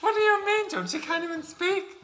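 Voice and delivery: high, loud scandalized shriek; shrill-pitched gossip outrage, half laughing; mock shock. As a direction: squeal it in hammed-up disbelief.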